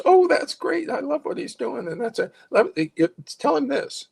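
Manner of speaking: bubbly high-pitched delight; excited Eliza. Retold or imitated. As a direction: gush like a delighted friend. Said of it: "Eliza goes".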